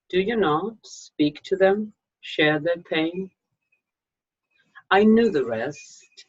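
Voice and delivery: ordinary talk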